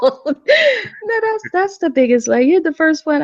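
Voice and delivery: laughing, pitched high; laughing protest. Real, not acted. Woman answers an insult meant as nice, laughing in protest.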